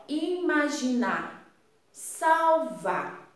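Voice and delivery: IMAGINAR, then SALVAR, each stretched with weight on the last syllable; rhyming endings stressed. Shown as a demonstration. Teacher showing pupils that two words rhyme, drawn-out endings. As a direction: slowly set "imaginar" against "salvar", leaning on the endings. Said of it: "Quando eu digo".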